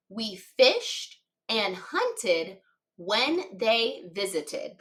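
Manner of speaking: each word said slowly and clearly; slow dictation. Shown as a demonstration. Teacher dictating a sentence to young writers, slow. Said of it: "Our sentence today is".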